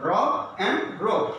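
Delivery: slow "rob and robe", open O then rounded O; rob versus robe. Shown as a demonstration. Teacher summing up the O contrast for learners. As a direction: set "rob" and "robe" apart slowly. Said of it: "This is".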